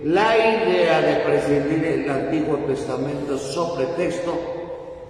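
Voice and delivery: loud, high, driving sermon voice; fervent preaching. Acted. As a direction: preach it at full force to the congregation. Priest preaching with rising force.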